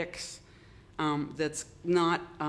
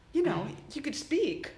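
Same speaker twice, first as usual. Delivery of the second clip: higher, pointed mock rebuke; indignant retort; 9 semitones higher than usual. To a man grabbing a napkin at the pizza shop, mock indignant. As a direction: needle the rude stranger with a raised pitch. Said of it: "and you're like".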